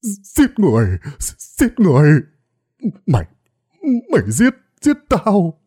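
choked, panicked cry; gasping cries. A narrator's reading. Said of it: "miệng ú ớ". (lão Kha ú ớ kêu hoảng loạn)

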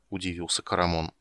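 ordinary delivery, nothing marked

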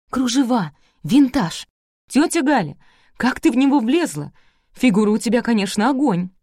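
high, loud admiring exclamations; excited raving. A narrator's reading. Girl admiring her aunt's dress, excited. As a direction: gush with each new detail.

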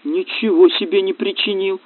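stifled cry of outrage; nearly shouting. A narrator's reading. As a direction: protest without a full shout. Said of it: "чуть было не закричал Сева".

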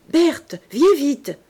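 a mother's anxious shout; urgent call. A narrator's reading. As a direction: call across the house in alarm. Worried mother calls her daughter.

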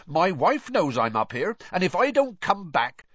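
high, loud, urgent warning; bold bluff. A narrator's reading. Intruder bluffs about someone waiting, loud and defiant. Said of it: "added on a sudden inspiration".

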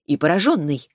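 ordinary delivery, nothing marked

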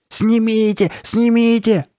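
high, loud, desperate cries; frantic shouting. A narrator's reading. Girl clawing at her face, frantic shouting. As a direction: yell it in a frenzy. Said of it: "Она стала яростно царапать своё лицо".

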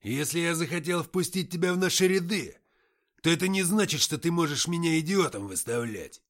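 loud, high enraged rant; angry tirade. Acted. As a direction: berate the new recruit. Antokha continues raging.

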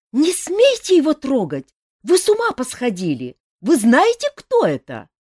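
loud alarmed shout, high. A narrator's reading. Азиль shouts at the brawlers to stop. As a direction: shout them down to stop the fight. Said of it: "и закричала".

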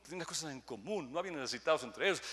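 normal talking voice

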